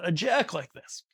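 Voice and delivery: ordinary talk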